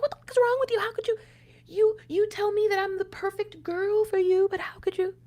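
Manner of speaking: high, strained, exasperated pleading; reliving the fury at him, voice pitched high; retold angry outburst. To her partner, recalling her anger: high exasperated cry. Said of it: "I was so angry".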